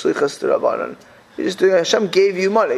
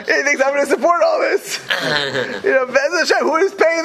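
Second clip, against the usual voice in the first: amused disbelief; 9 semitones higher than usual. Retold or imitated. Father-in-law retelling, laughing.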